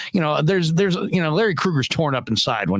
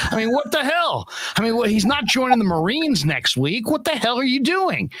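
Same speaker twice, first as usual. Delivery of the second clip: retold outrage; 9 semitones higher than usual. Outraged at the haircut.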